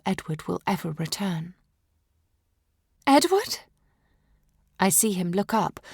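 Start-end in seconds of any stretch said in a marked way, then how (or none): 3.1-3.6 a high, rising, questioning call of the name; calling in sleep. A narrator's reading. Dreaming queen calling to her departing husband, high and questioning. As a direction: reach for him through the dream. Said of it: "call for him in my sleep".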